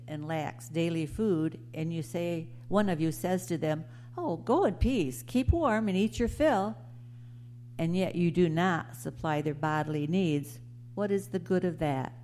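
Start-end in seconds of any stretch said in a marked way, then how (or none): none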